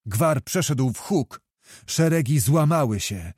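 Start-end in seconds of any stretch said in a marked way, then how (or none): none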